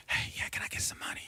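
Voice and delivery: hushed whisper at the counter. Retold or imitated. Lady asking the teller for cash, whispered. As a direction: whisper it so nobody hears. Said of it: "some lady just whispered".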